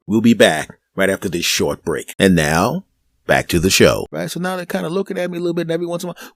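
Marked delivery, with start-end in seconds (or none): 0.1-4.1 lower, louder mock TV announcer. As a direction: boom it out like a network break.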